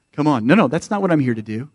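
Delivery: ordinary talk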